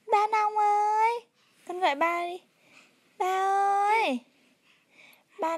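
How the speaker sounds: calling out. Real, not acted. Calling dad for the baby.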